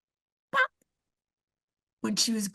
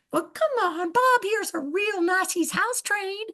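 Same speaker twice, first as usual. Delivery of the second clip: much higher, slow coaxing seller; Kathy Bates voice; 9 semitones higher than usual. Retold or imitated. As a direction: sweet-talk the buyer into a squirrel. Kathy Bates pitching squirrel.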